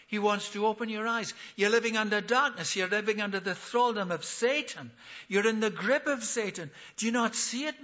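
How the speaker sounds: high, loud, urgent appeal; impassioned preaching. Acted. Paul pleads with King Agrippa to see the truth, impassioned preaching.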